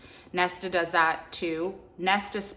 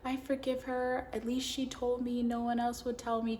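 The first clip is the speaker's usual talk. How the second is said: high, soft mimicry of a forgiving woman; Feyre; 6 semitones higher, 5 dB quieter than usual. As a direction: lift the voice and gently mock her forgiveness. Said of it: "Feyre is always so".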